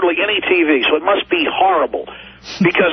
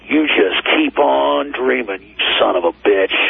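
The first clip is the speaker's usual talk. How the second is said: hateful snarl; 6 semitones lower than usual. Retold or imitated. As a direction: growl it like something out of The Exorcist. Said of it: "with the most hateful, like something out of The Exorcist".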